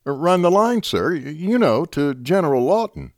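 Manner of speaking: nervous lieutenant proposing a bold idea; hesitant eager lieutenant. A narrator's reading. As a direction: stumble over it, then push on.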